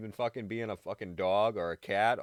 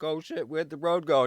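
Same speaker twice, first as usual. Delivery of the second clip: higher and louder, startled; startled exclaim; 6 semitones higher, 6 dB louder than usual. Retold or imitated. Driver suddenly losing the road, startled shout. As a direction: blurt it out as the road vanishes. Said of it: "everybody's like".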